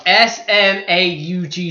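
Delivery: ordinary delivery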